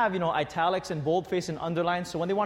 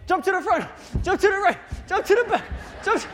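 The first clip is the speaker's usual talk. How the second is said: much higher, loud, hammed-up; mock coach commands; 14 semitones higher, 6 dB louder, faster than usual. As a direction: parody the coach's calls. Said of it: "I'm like".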